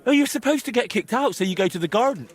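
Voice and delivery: normal talking voice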